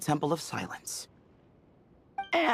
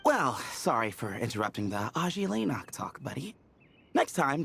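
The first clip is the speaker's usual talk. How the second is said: ordinary talk, nothing marked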